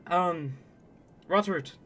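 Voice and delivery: put-on high, lively Canadian accent. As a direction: play him as a broad Canadian. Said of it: "just gonna be Canadians".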